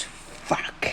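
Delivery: very soft, voiceless swear; whispered curse. Acted. Deku looks down and goes, a whispered curse. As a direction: curse under your breath. Defeated character curses on looking down.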